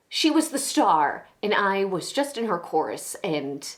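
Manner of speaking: resentment sinking into halting hurt; wounded, agitated confession; bitter, hurt confessing woman. Acted. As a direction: let the grievance falter between long pauses. Acted confession monologue.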